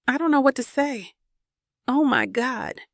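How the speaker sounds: struggling to get words out; stunned grief over a lost grandfather; lump in throat. A narrator's reading. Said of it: "A lump forms in my throat as I try to speak".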